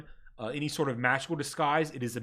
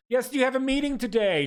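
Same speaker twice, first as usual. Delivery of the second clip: higher, prim bureaucratic clerk voice; desk clerk; 8 semitones higher, 5 dB louder than usual. Acted. Ministry clerk greets the ragged party, primly bureaucratic. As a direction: recite the rules like a tired official.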